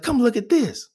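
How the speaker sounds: high-pitched mock call to a coworker; imitated calling out. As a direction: beckon a colleague over, mimicking her. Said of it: "she calls her out".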